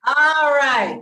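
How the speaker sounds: loud laughing restart. Real, not acted.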